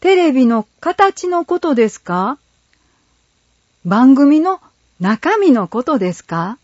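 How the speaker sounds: put-on high, puzzled child asking; lightly voiced kids wondering; boy voice. A narrator's reading. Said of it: "栗太郎くんと八作くんは思案顔".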